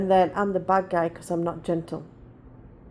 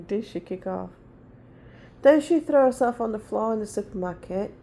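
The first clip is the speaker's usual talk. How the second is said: ordinary talk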